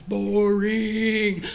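low, drawn-out word; usher's long, dull drawl; mocking boredom. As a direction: stretch it out slowly in tedium. Usher mocks the pastor.

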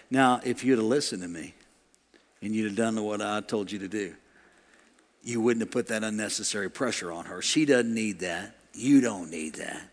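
low, soft, unhurried counsel; gentle Holy Spirit. Acted. Holy Spirit counseling a husband inside his heart, gentle low voice. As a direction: correct softly and low, without condemning. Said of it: "the Holy Spirit saying".

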